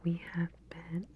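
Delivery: ordinary talk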